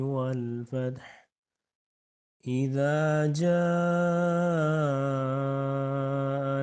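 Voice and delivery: slow, drawn-out tajweed chant; slow Quranic recitation. Shown as a demonstration. Teacher demonstrating slow recitation to students. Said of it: "تو آج ہم next سورہ پڑھیں گے".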